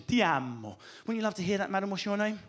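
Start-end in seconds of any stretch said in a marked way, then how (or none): 0.1-0.8 romantic Italian. Shown as a demonstration. Showing how beautiful love sounds in Italian, low romantic Italian. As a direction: breathe out a tender Italian declaration. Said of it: "it matches the emotion".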